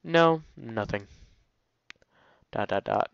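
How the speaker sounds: ordinary talk, nothing marked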